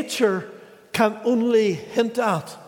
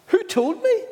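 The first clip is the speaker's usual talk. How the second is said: incredulous man; 12 semitones higher than usual. Retold or imitated. Man scoffs at girl's question.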